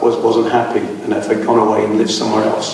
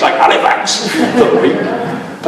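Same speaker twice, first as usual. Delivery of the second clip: higher, laughing mock-posh sneer; 10 semitones higher than usual. Lecturer mocking Vera's snobbery about Halifax, high and laughing. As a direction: send up her self-importance with a laugh.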